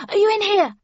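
high, loud worried call; anxious calling. A narrator's reading. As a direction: shout up the stairs, anxious. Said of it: "yelled Randolph anxiously".

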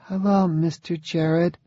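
put-on high, small little-girl voice; a shy child greeting a stranger; small, uncertain child. A narrator's reading. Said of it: "in a small, uncertain voice".

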